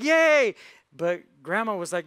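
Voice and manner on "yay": high, loud drawn-out cheer; kids' cheer. Acted. Kids hearing about fishing, an excited cheer. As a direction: cheer like delighted children. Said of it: "the kids are like".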